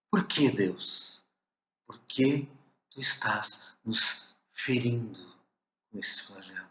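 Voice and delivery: put-on a wounded child asking God why, fading away; hurt plea to God. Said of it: "é assim que nós nos sentimos".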